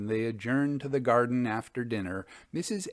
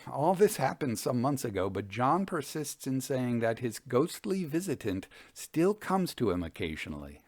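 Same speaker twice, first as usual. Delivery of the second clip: usual speaking voice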